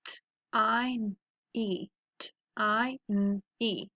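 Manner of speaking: "tiny" sound by sound, t, long i, n, ee; segmented phonics "tiny". Shown as a demonstration. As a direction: sound out "tiny" twice with gaps. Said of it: "So let's practice them".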